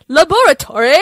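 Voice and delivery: hammed-up bad British accent, high and loud; Ross's bad accent. Quoting a sitcom teacher at one point, bad British accent. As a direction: do a badly faked British accent at high pitch. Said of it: "as bad as Ross's accent".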